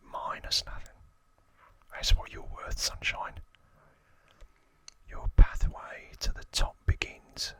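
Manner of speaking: lightly acted, whispered Cockney gang boss; Cockney accent. Gang boss telling a wannabe how to rule, whispered Cockney accent. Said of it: "That's my best Cockney accent".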